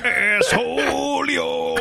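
slow, strained grunge-rock growl; wrestler voice. Retold or imitated. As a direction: drag it out like a wrestling promo. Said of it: "the wrestler voice is kinda how it is".

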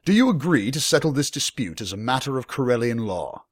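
measured lord demanding agreement; formal young lord. Acted. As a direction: put the terms to him officially.

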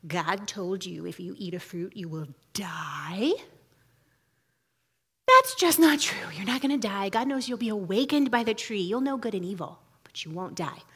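a tempter luring Eve in a soft, low murmur; hushed serpent. Retold or imitated. Snake who comes in and is like.

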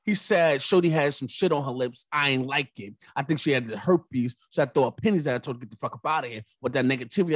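usual speaking voice

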